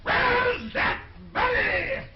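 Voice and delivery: very high, slow, drawn-out shout; impatient bellow. Acted. King calling to servants for his money, bellowing impatiently. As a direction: holler it through the palace.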